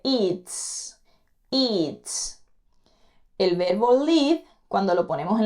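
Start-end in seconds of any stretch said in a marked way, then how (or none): 0.0-2.4 "Eats" ending in a crisp S sound; pronounce with S. Shown as a demonstration. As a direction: say "eats" with a clean final S. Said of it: "se pronuncia como una S".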